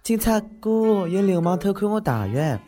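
slow, high wheedling report; whiny plea to police. Acted. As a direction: drag it out sweetly to the cop.

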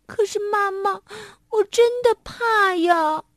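high, crying plea, slowing down; a child near tears saying he is afraid; tearful little monkey. A narrator's reading. Little monkey tells mother he is truly scared, high and tearful.

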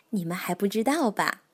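ordinary delivery, nothing marked

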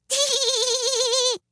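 very high, drawn-out titter; little woman's high tittering laugh; irrepressible giggle. A narrator's reading. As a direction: let a tee-hee burst out. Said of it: "could not keep from laughing".

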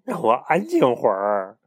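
loud, slow impatient reply. Acted. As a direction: brush him off impatiently. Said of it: "不耐烦地回答".

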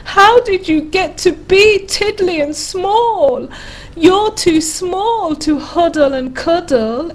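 high, wailing storybook bear; bawling bear. A narrator's reading. Bear sobbing to a teddy too small to cuddle, bawling. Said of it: "could hear the voice bawl".

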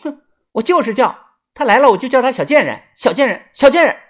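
high, loud, fast taunting; petulant angry chant of the insult; annoyed retort. A narrator's reading. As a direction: stubbornly insist and jeer. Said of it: "立即恼道".

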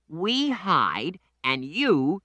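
put-on high, slowish kid explaining; Danny the boy. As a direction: explain the game like an eager boy. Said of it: "said Danny".

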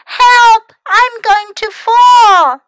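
high, loud cry for help; panicked cry. A narrator's reading. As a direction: shout in alarm, dragging out the words. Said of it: "Then he cried".

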